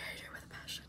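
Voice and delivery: fast unvoiced whisper of hatred; whispered disgust. Real, not acted. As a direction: hiss the dislike under your breath. Emphatic dislike, then whisper.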